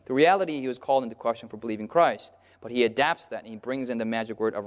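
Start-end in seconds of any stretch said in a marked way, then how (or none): none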